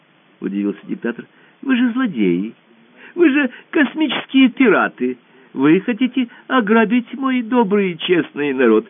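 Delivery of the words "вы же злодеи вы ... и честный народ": high, lifted voice of an indignant ruler; indignant dictator. Acted. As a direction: sound shocked at the villains. Dictator accuses pirates.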